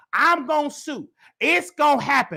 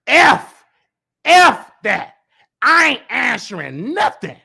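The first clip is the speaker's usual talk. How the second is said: loud, mocking angry snap at the comment; imitating an upset host, loud; mock angry outburst; 8 dB louder, slower than usual. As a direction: shout it back like he's in his feelings. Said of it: "Getting mad, in his feelings, mad, upset".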